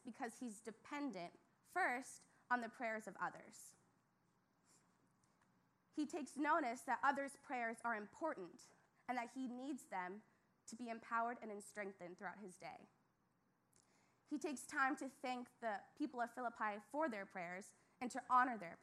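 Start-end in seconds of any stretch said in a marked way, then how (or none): none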